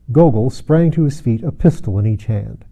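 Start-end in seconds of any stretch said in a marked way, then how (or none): none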